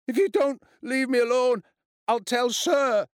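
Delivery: high, loud desperate threat; shaking with fear. A narrator's reading. Frightened boy recites threat to tell teacher, shaking. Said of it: "David was shaking".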